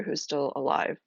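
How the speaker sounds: normal talking voice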